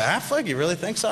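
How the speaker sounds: high, incredulous "Affleck? You really think so?"; surprised disbelief. Retold or imitated. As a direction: play it as a doubter who can't believe the pick. Quoted director, disbelieving.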